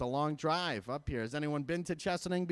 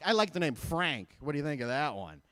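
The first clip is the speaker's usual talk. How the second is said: ordinary talk, nothing marked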